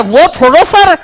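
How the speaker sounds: high, loud, slow bragging pupil; boastful student Champat. Retold or imitated. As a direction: show off like a cocky schoolboy. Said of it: "Champat बोलता है". (शेखी बघारता छात्र, ऊँची आवाज़ में)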